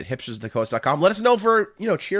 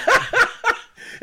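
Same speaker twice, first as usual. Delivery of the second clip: very high delighted cackle; 5 dB louder than usual. Real, not acted. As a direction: cackle out loud. Laughs at Jerry.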